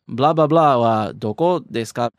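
blah blah blah wa doko desu ka said flat, not like a question; a wrong, flat question tune; flat non-question tune. Shown as a demonstration. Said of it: "the intonation is not in the form of a question".

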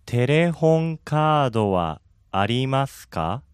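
slow, careful テレホンカードはありますか; over enunciating. Shown as a demonstration. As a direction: spell out テレホンカードはありますか slowly. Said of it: "One more time, slowly, please".

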